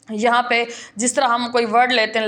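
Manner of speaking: ordinary talk, nothing marked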